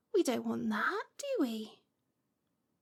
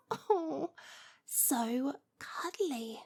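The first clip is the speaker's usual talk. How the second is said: very slow, soft whispered coo; 5 dB quieter, much slower than usual. Acted. Witch softly whispering about cuddly cats. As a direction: melt over how cuddly cats are.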